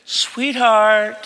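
imitating a sugary parent; sweet calling. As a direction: coo it to a child. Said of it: "house and said".

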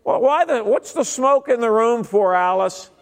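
high, huffy complaint, slowed; ticked-off churchgoer. Retold or imitated. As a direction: whine it like an offended old member. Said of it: "they're ticked".

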